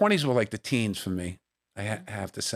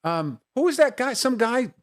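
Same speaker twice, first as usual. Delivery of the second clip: higher, curious mock questioning; imitating Phil Simms; 10 semitones higher, 3 dB louder than usual. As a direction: play the curious football player asking. Retelling Phil Simms asking him.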